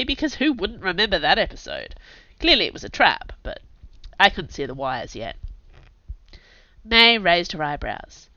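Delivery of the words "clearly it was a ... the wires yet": plain reading voice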